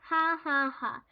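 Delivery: read-out, half-hearted ha-ha teasing; mock hysterical laughing. A narrator's reading. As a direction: tease with a put-on laugh. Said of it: "laughing hysterically".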